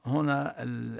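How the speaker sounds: ordinary delivery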